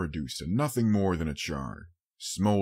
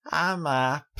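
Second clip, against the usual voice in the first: put-on high, louder little-girl voice; a small child insisting; young filly, waking; 10 semitones higher, 5 dB louder than usual. A narrator's reading. Child character voice.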